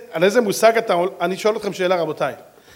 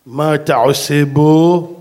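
slow, loud challenge to an intruder; menacing interrogation; 2 semitones lower, 9 dB louder, much slower than usual. Retold or imitated. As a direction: drag out each word loudly and slowly. Stranger confronts the driver at night.

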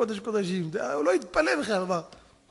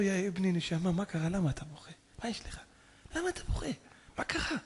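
soft, tender questioning; acted rabbi's hushed concern; gentle consoling; 4 semitones lower, 9 dB quieter than usual. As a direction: coax a crying child softly. Rabbi consoling a crying child.

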